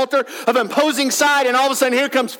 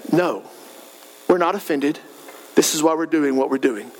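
much lower, unruffled voice; simple, unoffended reply; 9 semitones lower than usual. Acted. Accused people answering without getting defensive, low and calm. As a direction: answer plainly and calmly, taking no offense. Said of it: "they just simply say".

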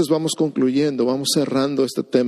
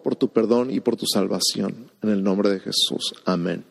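prayer. Acted. Leads a sinner's prayer.

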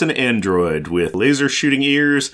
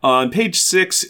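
ordinary talk, nothing marked

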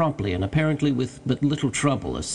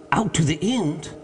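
raised-pitch, incredulous question; mild mock scream; 6 semitones higher than usual. A narrator's reading. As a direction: echo it back in understated astonishment. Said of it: "here fairly screamed Legrand".

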